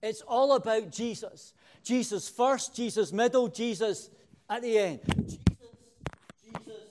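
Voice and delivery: rising preacher fervour, then fading to almost nothing on the last Jesus; carried away. Real, not acted. Preacher getting carried away with his congregation, excited then fading. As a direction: swept up in the praise, then trailing off. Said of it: "I'm getting carried away with myself".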